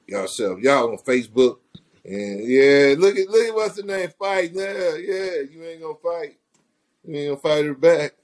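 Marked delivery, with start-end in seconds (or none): 2.4-8.1 high mocking clown voice; taunting clown voice, pitched up; silly clown. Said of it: "In this clown voice".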